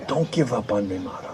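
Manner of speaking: soft, low murmur into his ear; retold whisper. Devotee to hugging swami, softly half-whispered. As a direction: murmur it low, as if mid-hug. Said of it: "I whisper in his ear".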